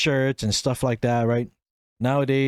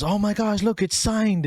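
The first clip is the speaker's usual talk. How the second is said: much higher, amazed; excited exclaim; 10 semitones higher than usual. Retold or imitated. A guest spotting the signature, high and amazed. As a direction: point it out like a thrilled guest.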